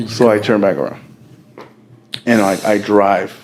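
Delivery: ordinary talk, nothing marked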